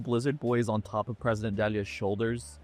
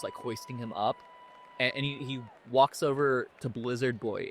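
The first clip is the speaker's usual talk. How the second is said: ordinary talk, nothing marked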